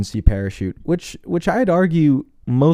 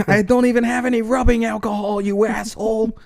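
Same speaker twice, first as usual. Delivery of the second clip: very high squealing villain; whiny villain voice; 12 semitones higher than usual. Retold or imitated. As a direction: squeal the insult. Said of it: "villain voice continues".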